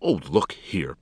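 insistent appeal; pleading. A narrator's reading. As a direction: plead with a touch of protest. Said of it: "pleaded Alexander".

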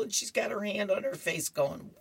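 ordinary delivery

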